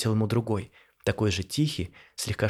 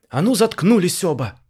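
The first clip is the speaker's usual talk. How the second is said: loud, much higher order; commanding thunder; 8 semitones higher, 7 dB louder than usual. A narrator's reading. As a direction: silence them both with authority. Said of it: "властно прогремела вдруг Марина".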